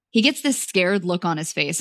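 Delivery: ordinary delivery, nothing marked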